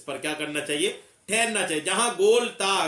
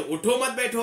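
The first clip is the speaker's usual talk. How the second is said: उठो मत बैठो in one run, higher; no pause after उठो; 4 semitones higher than usual. Shown as a demonstration. Teacher warning what happens without stopping, उठो मत बैठो unbroken. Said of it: "अगर ना ठहरा जाए तो".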